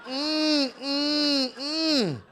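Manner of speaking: high, whiny mock-offended hums; mock offended whimpers. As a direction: imitate Italians whimpering in offense. Italians' offended reaction imitated.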